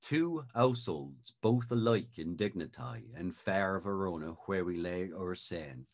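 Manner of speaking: Shakespeare's original pronunciation, seine for scene. Shown as a demonstration. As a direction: recite the prologue in Elizabethan original pronunciation. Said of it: "in original pronunciation".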